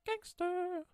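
very high, loud praise; hyped shout. Real, not acted. Host hyping the ventilator makers, high shout. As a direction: yell it like a hype man.

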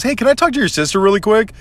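high, quick, casual imitation of cousin; mock-innocent phone voice; friendly phone request.

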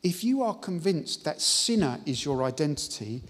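full-force pulpit preaching; fervent preaching. Acted. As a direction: proclaim it to the congregation.

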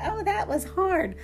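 high, whining client voice; whiny complaint. Acted. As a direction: whine like a tired gym client. Client complaining about a workout.

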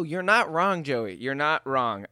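ordinary talk

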